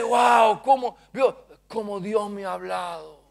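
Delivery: marvel, then hush; mock-amazed listener; awed wow. Believer marvels at the word.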